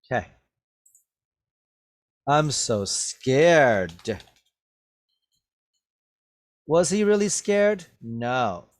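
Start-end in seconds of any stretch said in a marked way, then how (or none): 2.2-4.2 very slow, louder mock fear; sarcastic. Shown as a demonstration. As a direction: stretch it out, not meaning a word. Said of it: "He said that sarcastically".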